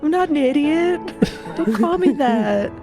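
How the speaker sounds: ordinary delivery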